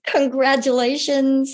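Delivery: warm congratulations. Retold or imitated. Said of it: "She looked right in my eye and she said".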